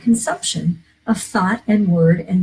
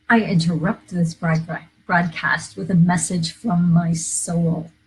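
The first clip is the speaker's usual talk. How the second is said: ordinary delivery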